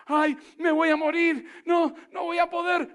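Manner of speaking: voz más aguda y a gritos, quejosa; creyente asustado. Acted. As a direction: imita a un creyente que entra en pánico. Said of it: "En otras palabras". (creyente quejoso en pánico, más agudo y a gritos)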